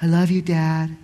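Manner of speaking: soft, lightly voiced little kid; tender child saying goodnight; gentle hint of a child; small child's voice. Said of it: "my kid just says".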